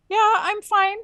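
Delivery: a hammed-up rattled answer; imitating a frantic woman. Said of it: "freaking out".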